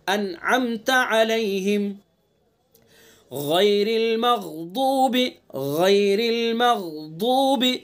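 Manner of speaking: slow melodic tajweed chant; Quran recitation. Shown as a demonstration. Teacher reciting the verse slowly for students. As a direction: draw each syllable out in recitation.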